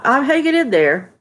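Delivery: ordinary talk, nothing marked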